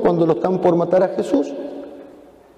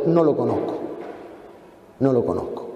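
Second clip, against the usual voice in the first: lower, softer, ashamed; low quiet denial; 4 semitones lower, 6 dB quieter than usual. Acted. Peter's last denial, low and ashamed. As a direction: mutter the denial in shame.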